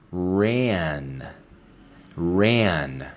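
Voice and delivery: "ran" said clearly as /ræn/, twice; model pronunciation. Shown as a demonstration. Teacher modelling past tense "ran" for students. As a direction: say "ran" with a clean open a. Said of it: "escucha la pronunciación muy atentamente".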